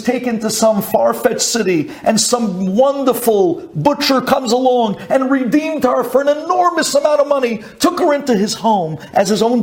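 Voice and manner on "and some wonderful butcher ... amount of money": ordinary talk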